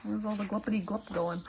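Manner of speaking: ordinary delivery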